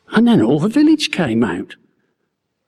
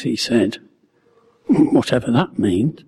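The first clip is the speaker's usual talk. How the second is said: usual speaking voice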